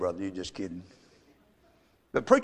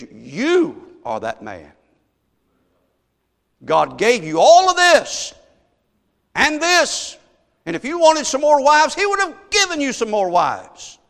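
slow, then shouted high and loud accusation; thundering rebuke; 9 semitones higher, 7 dB louder, slower than usual. Retold or imitated. Preacher voicing Nathan rebuking King David, loud and accusing. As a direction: drive each word home like a prophet condemning.

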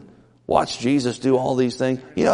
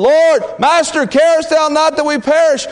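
panicked screaming; 13 semitones higher, 5 dB louder than usual. Acted. Disciples screaming to Jesus in the storm, panicked. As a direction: scream it like the boat is sinking. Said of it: "screaming and yelling".

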